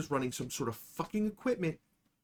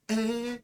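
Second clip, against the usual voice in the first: mock pained grunt; 7 semitones higher, 4 dB quieter than usual. Getting punched in belly.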